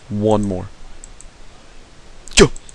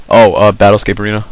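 ordinary talk, nothing marked

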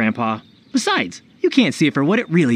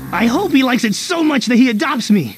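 high, gushing fan excitement; eager hope; 6 semitones higher than usual. Acted. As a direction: gush about the hero's arrival. Eager fan awaiting the hero.

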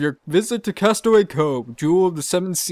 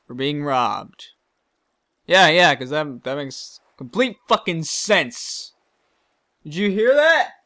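usual speaking voice

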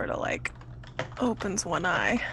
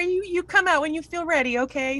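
high, sweet character voice; reassuring, high-voiced lady; coaxing Ursula; 11 semitones higher than usual. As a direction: coax a shy creature out, pitched up.

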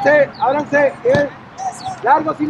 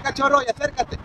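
ordinary delivery

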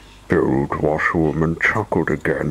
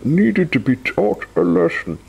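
lightly voiced wizard; 10 semitones higher than usual. Wizard scolding Mister Mean, lightly voiced high. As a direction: lecture like a playful wizard. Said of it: "added the wizard".

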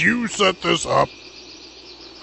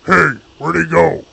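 normal talking voice

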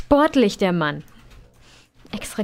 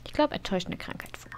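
whisper; 8 dB quieter, faster than usual. Real, not acted. Aside guess while reading.

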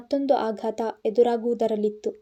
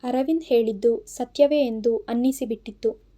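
ordinary delivery

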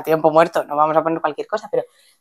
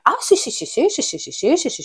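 eager mock agreement; 7 semitones higher than usual.

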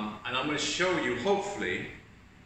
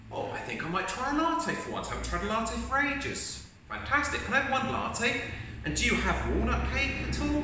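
much higher, easygoing acceptance; positive customer; 10 semitones higher than usual. Acted. Positive customer told there is no cappuccino, much higher and easygoing. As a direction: shrug off the bad news gladly. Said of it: "show you how ... in a positive way".

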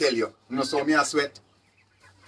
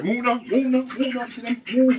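high, coaxing calls of move now; urging animals on; 9 semitones higher than usual. Real, not acted. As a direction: shoo them along with a high coaxing call. Urging animals into new house.

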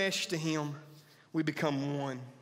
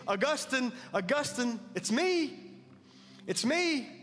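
calling after him, higher, building on it's me; mock yelling out; 7 semitones higher than usual. Old lover calls after Augustine, imitated yelling. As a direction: call out his name as he walks away. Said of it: "she's yelling at him".

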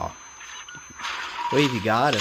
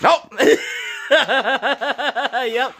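high laughing reaction; 9 semitones higher than usual. Real, not acted. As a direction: crack up, high.

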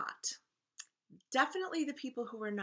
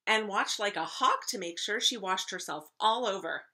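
loud, animated read-aloud; emphatic story narration; 8 dB louder than usual. A narrator's reading. Teacher reading to first graders, emphatic story narration. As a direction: narrate with big stresses on key words.

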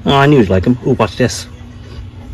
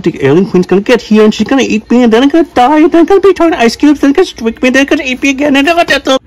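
higher, rushing worried rant; spiralling into panic; panicky rambling; 10 semitones higher than usual. Acted.

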